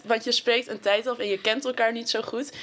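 ordinary delivery

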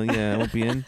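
normal talking voice

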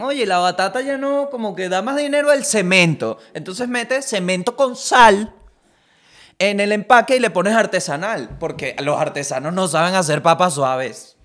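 a company boss selling cement chips; scheming executive. Retold or imitated. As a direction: pitch it like a greedy boardroom idea. Voicing Lays executives' plan.

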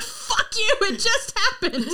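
high-pitched protest with laughter; mock outrage. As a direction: protest in hammed-up distress. Retells outraged reply to friend.

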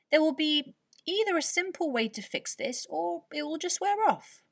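put-on high, older grandma voice; Nana's voice. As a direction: soothe them as a wise old nana. Nana calms worried family.

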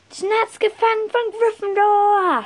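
viel höher, lauter, langsam ausgerufen; geschrien. Retold or imitated. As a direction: ruf es wie ein Stadionsprecher heraus. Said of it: "schrie ich". (lauter, hoher Jubelschrei)

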